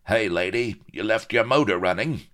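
rowdy wolf-whistler's shout; catcall. A narrator's reading. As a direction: call out cheekily to a passing lady. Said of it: "wolf whistles and cries of".

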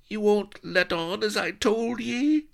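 very high, fearful rustic quaver. A narrator's reading. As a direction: ask nervously in a quavering old voice. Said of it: "quavered Solomon".

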